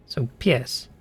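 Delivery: pies said the Polish way, P and S split and the vowel short; careful Polish pies with a clear P-ie-S; Polish pronunciation. Shown as a demonstration. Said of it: "Like that".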